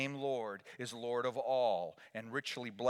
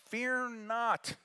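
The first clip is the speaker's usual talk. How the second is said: much higher second take; raised proclamation; 12 semitones higher, 5 dB louder than usual. Shown as a demonstration. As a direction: lift it up like the angel's call.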